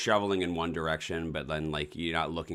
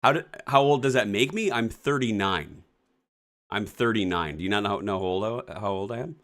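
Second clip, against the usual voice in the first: indignant; 4 semitones higher, 5 dB louder than usual. Real, not acted. Indignant at partner's question.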